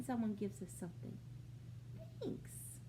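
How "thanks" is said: whispered thanks. Shown as a demonstration. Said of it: "what does he say?".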